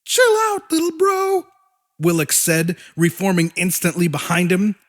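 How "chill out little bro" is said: high monster voice. A narrator's reading.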